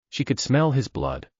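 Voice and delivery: ordinary delivery, nothing marked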